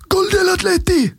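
high mock scream in Spanish. Fitness coach with a radio on the bench: high mock scream. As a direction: shriek it like a fitness coach hearing a goal on the radio. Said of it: "skriker han".

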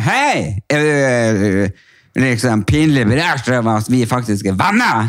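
put-on slow, loose drunken delivery. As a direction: draw it out like you've had too many. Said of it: "Akkurat sånn stemme har du når du er full". (full og langtrukken fyllestemme)

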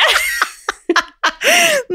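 long high laugh; real hearty laugh. Real, not acted. Laughs at spydd galle.

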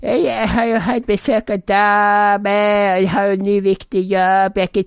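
mock a colleague's excuses in a high put-on voice; Lars-imitasjon. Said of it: "Lars som vanlig". (ertende etterligning med lys, tilgjort stemme)

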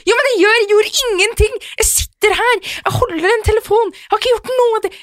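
very high, loud, exasperated self-defence; defensive high protest. Retold or imitated. Speaker defending herself to her partner on the phone, high exasperated protest. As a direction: protest your innocence, fed up. Acted phone quarrel reply.

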